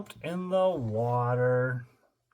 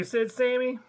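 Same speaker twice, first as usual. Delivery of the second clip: ordinary delivery, nothing marked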